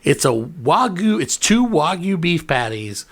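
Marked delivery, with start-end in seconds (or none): none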